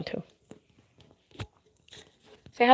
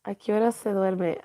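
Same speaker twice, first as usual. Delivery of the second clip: usual speaking voice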